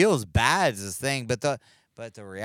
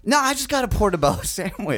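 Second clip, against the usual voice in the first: excited fan voice; 10 semitones higher, 5 dB louder, faster than usual. Acted. Brendan character.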